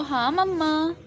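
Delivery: put-on high child-like prompt. Shown as a demonstration. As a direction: say it the way the child should. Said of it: "बोलो".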